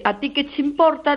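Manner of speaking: sing-song mock of a rude neighbour; mocking retort. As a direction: mimic her brushing you off. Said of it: "si a mí me dice".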